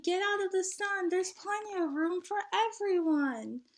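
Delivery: cheerful call from the bus; shouted invitation. Acted. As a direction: beckon them out of the sun. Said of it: "someone shouted".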